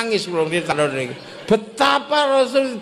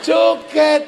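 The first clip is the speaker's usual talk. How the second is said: joyful shout; 3 semitones higher than usual. Retold or imitated. Villager dances for joy.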